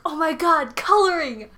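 put-on high, loud burst of childlike excitement; mock an overjoyed five-year-old; really excited. Retelling the man's thrill at drawing, really excited. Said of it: "he gets really excited".

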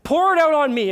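high, loud, emphatic sermon delivery; full-force preaching. Acted. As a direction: preach it out as Christ's urgent plea. Preaches as Christ pleading.

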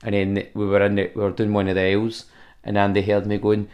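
ordinary talk, nothing marked

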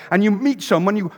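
usual speaking voice

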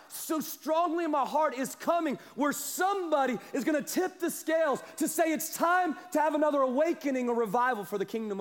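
climbing pulpit fervour; full-force preaching. Acted. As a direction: preach it out to the room.

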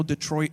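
Detroit said the French way, "day-twah"; "Detroit" with a French "-trwah" ending; French pronunciation. Shown as a demonstration. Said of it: "is actually pronounced Detroit".

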